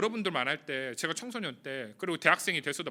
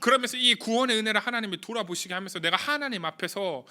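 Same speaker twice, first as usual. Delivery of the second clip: louder, higher impassioned preaching; preaching at full force; 5 semitones higher, 6 dB louder than usual. Acted. Pastor exhorting the youth group, rising fervent preaching. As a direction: build the sermon to its fervent peak.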